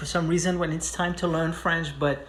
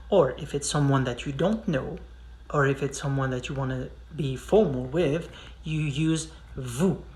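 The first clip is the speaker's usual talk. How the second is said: ordinary talk, nothing marked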